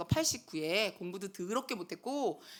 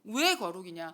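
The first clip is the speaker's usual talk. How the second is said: usual speaking voice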